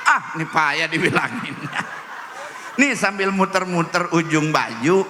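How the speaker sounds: ordinary delivery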